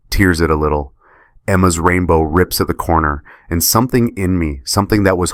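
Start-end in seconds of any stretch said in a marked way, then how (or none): none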